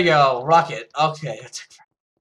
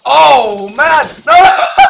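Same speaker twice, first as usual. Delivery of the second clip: laughing shout; 9 semitones higher, 13 dB louder, slower than usual. Real, not acted. Getting beaten in the fight.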